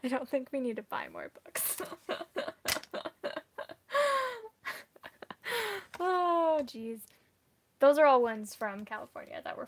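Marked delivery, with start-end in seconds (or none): 1.8-5.9 high, crying-like laugh. Real, not acted. Laughing at herself for buying more books, high and teary. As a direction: let a high laugh break out.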